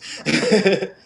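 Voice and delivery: loud rising laugh; real amused laugh. Real, not acted. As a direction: laugh out at the memory. Laughs at brother's joke.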